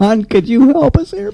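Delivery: high-pitched call for help; high plea. Real, not acted. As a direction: squeak out a plea to John. Calling out for help.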